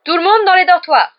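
high, loud command; shouted order. A narrator's reading. Prefect Percy sends everyone to bed, shouting. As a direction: call out to clear the whole room. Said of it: "s'écria Percy".

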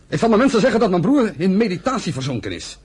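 ordinary talk

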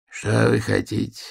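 put-on rattling old man's voice; a quavering, cracked old voice; old prospector. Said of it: "дребезжащим голосом сказал старик". (дребезжащий старческий голос)

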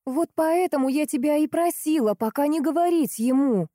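high-pitched scolding complaint; reproachful. A narrator's reading. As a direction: chide her for telling. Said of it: "попеняла ещё раз Лиза Мане".

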